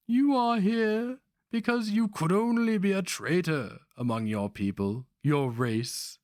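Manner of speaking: put-on high, needling voice of a scheming old master; sly old sorcerer. A narrator's reading. Old Grandmaster needling his captive barbarian, high and sly. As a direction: tease the captive with silky menace.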